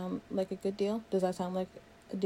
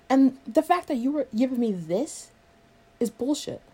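louder, higher, heated; raised voice, outraged; angry accusation; 5 semitones higher, 6 dB louder than usual. Acted. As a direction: hit the accusation hard. Acted quarrel, angry accusation.

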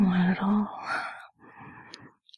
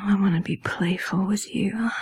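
normal talking voice

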